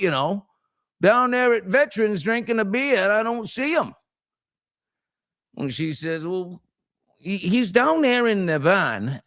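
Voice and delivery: high, drawling Down East storyteller; folksy joke telling. Acted. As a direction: spin the yarn like an old Mainer. Tells a folksy Maine joke.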